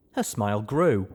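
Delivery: plain reading voice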